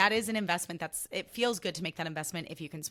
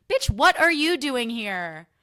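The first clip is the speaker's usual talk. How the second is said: louder, slower mock-defiant retort; indignant; 4 semitones higher, 11 dB louder, slower than usual. Real, not acted. Answering people who question her presence, indignant. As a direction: throw the question back at them. Ranting back at condescending acquaintance.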